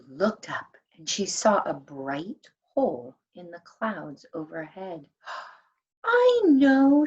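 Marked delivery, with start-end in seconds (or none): 6.1-7.1 excited. Acted. Elf's sudden realisation.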